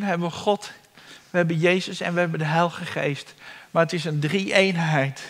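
usual speaking voice

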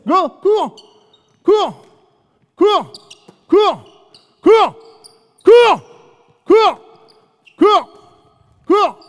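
loud, high "cours" repeated, peaking in the middle; shouted command. Real, not acted. Coach yelling at player on court to run. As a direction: drive them on with a yell each beat.

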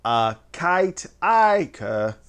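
Akaitika drawn out very slowly with a long "eye"; alternative pronunciation. Shown as a demonstration. Host trying another reading of the name, alternative pronunciation. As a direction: sound out Akaitika slowly, i as in "kite". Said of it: "I guess you can say".

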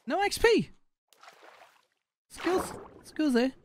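squeaky mock voice. Mocking another player's skills, high squeaky jeer.

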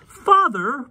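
very high, loud cry; girl crying out. Acted. Polly crying out, very high loud girl. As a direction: protest at her father's choice. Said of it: "Polly cried".

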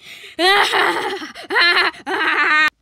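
loud, high wailing laugh; hammed-up outburst. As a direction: burst out in loud, high cackling cries. Outburst in the puppet skit.

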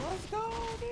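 cracking, squeaky strained voice. Real, not acted. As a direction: voice breaking on a shout. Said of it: "I'm dying, my voice".

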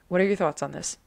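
a slight New York accent on the question; accidental light New York accent. Real, not acted. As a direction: let a hint of New York slip into the vowels. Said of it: "I went really New York".

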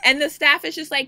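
ordinary talk

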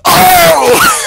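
surprised shout. Real, not acted. Teammate's shot lands.

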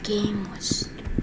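whispered grumble. Real, not acted. Gamer whispering annoyance after the round. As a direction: mutter the complaint under your breath.

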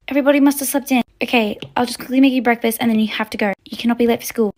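ordinary talk

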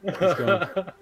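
slow, high words through laughter; laughing gleefully at a friend. Real, not acted. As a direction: gloat while laughing.

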